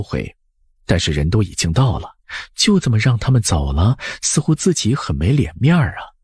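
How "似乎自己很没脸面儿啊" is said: plain reading voice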